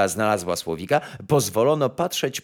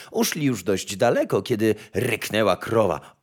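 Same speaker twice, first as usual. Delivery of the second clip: plain reading voice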